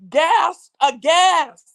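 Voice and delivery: gasping in shock, loud. Real, not acted. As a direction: act out the gasp. Acting out traitors' shock.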